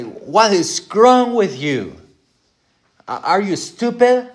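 put-on high, loud furious shout at a child; acted parent losing his temper; angry yell at child. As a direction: blow up at the kid, really loud and high. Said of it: "yell at him".

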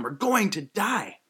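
high, drawn-out mock yell; retold yell. Disciples yelling at Jesus in the boat, acted desperation. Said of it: "they kinda yell at him".

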